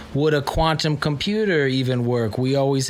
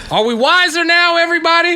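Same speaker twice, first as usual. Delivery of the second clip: calling out; 8 dB louder, faster than usual. Real, not acted. Calling out to the room.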